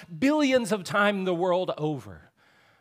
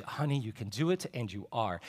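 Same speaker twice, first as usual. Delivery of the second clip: softer soothing words; tender reassurance; 6 semitones lower, 7 dB quieter than usual. Retold or imitated. Doula caressing the wife's hair, quietly soothing. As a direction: gently coax her on. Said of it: "caressing her hair".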